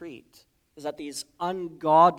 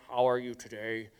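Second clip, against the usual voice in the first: lightly put-on reverend voice; 4 semitones lower, 3 dB quieter than usual. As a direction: greet like a syrupy reverend. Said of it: "pastor or reverend voice".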